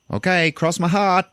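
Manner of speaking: high, slow mock vow; sing-song cross your heart, hamming it; mock solemn promise. Charlotte promises the tense group, in a high mock vow. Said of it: "And he's like".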